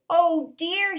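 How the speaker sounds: high, upset "oh dear"; a bird wailing in dismay; mild mock wail; crying. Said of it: "he cried".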